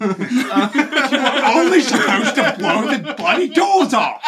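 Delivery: high, slow, giggly Cockney quote; laughing Caine impression. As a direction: try Caine while laughing. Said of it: "can't even do it".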